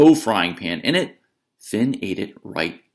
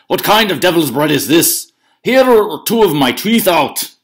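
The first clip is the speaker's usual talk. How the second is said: loud, higher indignant roar; outraged shout; 9 semitones higher, 10 dB louder than usual. Acted. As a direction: bellow at the broken teeth. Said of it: "shouted Cucullin".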